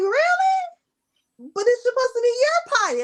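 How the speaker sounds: mock disbelief. Mom mock-incredulous at toddler.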